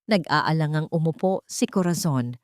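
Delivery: normal talking voice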